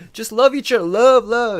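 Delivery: high, loud sing-song hippie; mock dreamy love preaching; sappy preacher. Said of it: "I'm like".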